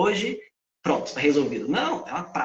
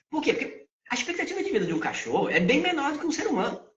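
ordinary talk

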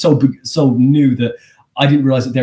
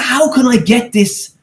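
elated; 12 semitones higher than usual. Retold or imitated. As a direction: beg for more, giddy with success. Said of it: "I was elated".

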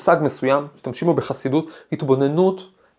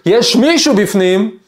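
high, louder, slow shout through the door; mock yell from inside the locked toilet; retold yell; 7 semitones higher, 5 dB louder, slower than usual. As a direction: yell it like a man trapped in the toilet. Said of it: "והוא צועק".

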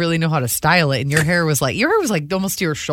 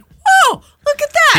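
amazed exclamation; 6 dB louder, slower than usual. Retold or imitated. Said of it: "I was like".

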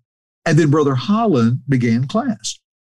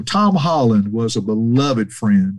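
normal talking voice

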